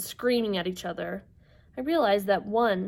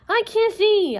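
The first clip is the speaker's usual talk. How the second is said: high, loud panicked cry; yelling; 13 semitones higher, 9 dB louder, slower than usual. Acted. Sisters who lost their eye, panicked yelling. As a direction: yell out in blind panic. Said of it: "all the sisters yelled".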